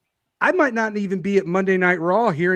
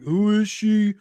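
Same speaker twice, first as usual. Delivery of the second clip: mocking hater voice; 5 semitones higher than usual. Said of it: "stupid ass comment like".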